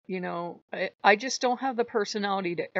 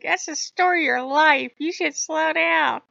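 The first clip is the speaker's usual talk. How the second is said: mock chiding; 10 semitones higher, 8 dB louder than usual. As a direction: chide in a raised, stretched voice. Voices Loki chiding her.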